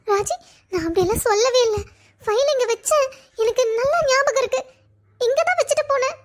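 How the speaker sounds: high, crying insistence; sobbing self-defence, pitch climbing; tearful protest. Acted. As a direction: plead innocence through tears. Crying girl denies accusing friend.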